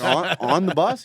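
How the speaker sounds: loud, high, slow disbelief; stunned question. Retold or imitated. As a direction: check you heard that invitation right. Acts out his own disbelief when invited.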